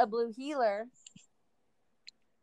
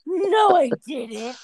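mock denial; 6 semitones higher, 9 dB louder, slower than usual. Voicing mom's denial.

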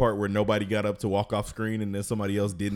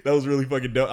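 ordinary talk

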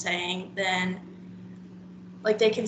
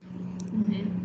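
normal talking voice

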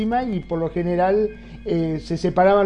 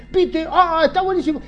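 excited friend; 9 semitones higher than usual. Retold or imitated. Customer bragging to a friend.

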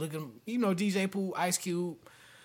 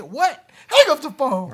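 higher, louder, incredulous what; startled what; 10 semitones higher, 10 dB louder, slower than usual. Retold or imitated. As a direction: act shocked and hang up. Reacting to census call.

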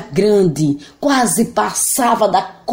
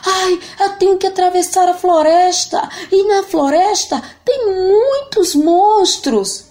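voz de menino muito aguda, choramingando; scared boy whining; 13 semitones higher than usual. Acted. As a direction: menino apavorado quase chorando. João com medo. (menino choroso com medo)

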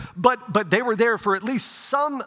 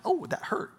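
pained yelp; 9 semitones higher, 6 dB quieter than usual. Retold or imitated. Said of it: "I'm like".

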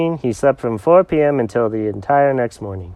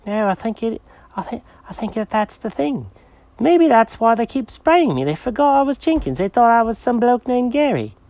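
high, eager cat character; excited cat voice; 12 semitones higher than usual. Acted. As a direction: bounce it out like an excited kitty. Jenkins voice.

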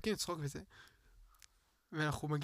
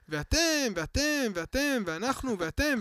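imitating preachy driver; 5 semitones higher, 5 dB louder, much slower than usual.

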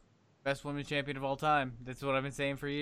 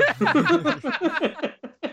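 loud high burst of laughter; cracking up loudly; 13 semitones higher, 11 dB louder than usual. Real, not acted. Laughing at watch-along joke.

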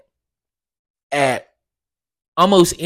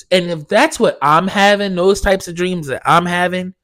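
ordinary delivery

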